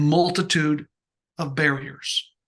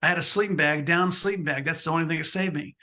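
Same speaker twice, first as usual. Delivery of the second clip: ordinary delivery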